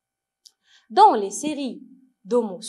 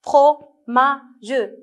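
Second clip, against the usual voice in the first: "Fromage" loudly split as fro, ma, ge; "Fromage" with the final ge sounded as its own syllable; 5 dB louder than usual. Shown as a demonstration. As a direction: count out "Fromage" in three separate beats. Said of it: "Où on entend la syllabe ma".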